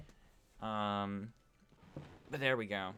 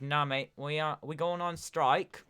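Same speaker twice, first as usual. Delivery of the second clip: put-on British accent, higher and louder; 5 semitones higher, 6 dB louder, slower than usual. Teachers announcing a strike to students, in a mock British accent. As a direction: play a mate with a hammed-up British accent. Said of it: "do it in a non-Canadian accent, but happens to be British".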